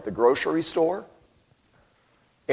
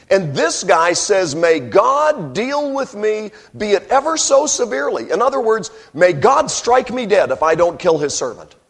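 much higher, louder fervent preaching; full force preaching; 7 semitones higher, 6 dB louder than usual. Acted. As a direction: drive the point home to the crowd.

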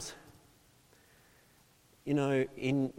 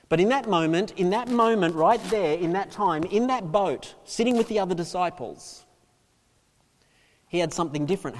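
raised, insistent sermon delivery; forceful preaching; 6 semitones higher, 7 dB louder than usual. Acted. Preacher placing listeners in the boat, preaching forcefully. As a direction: hammer each repeated phrase home.

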